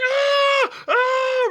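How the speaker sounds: high, loud drawn-out scream; imitated full-lunged scream. Retelling the neighbour's night of screaming, imitated loud scream. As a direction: scream bloody murder, mimicking him. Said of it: "screaming at the top of his lungs".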